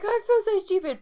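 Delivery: high, loud, crying outburst; retold tearful cry. To her doctor, a high loud tearful outburst. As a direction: blurt it out through tears. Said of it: "I actually cried to my doctor".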